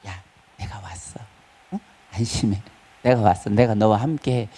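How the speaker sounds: soft, low comforting as Jesus; tender reassurance. Acted. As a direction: hush a frightened friend gently. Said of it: "예수님이". (예수님이 두려워하는 제자들에게 부드럽게 속삭이는 목소리)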